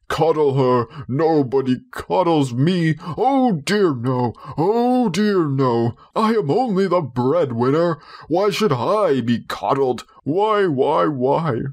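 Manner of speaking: high, whining hurt husband; sulking for sympathy, drawn out; self-pitying father. A narrator's reading. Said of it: "father's self-pitying outburst".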